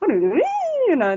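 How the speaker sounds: high swooping sing-song; mock Australian sing-song inflection; imitating Australian rising tune. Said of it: "they go all the way".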